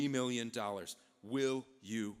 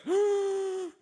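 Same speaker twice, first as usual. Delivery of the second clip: high, loud intake of breath; shocked gasp; 12 dB louder than usual. Acted. Envoy hearing Napoleon's price, big shocked gasp. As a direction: gasp in exaggerated amazement.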